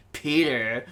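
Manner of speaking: lightly voiced sinister goblin; a hint of a menacing villain; Green Goblin. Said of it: "Do your goblin voice".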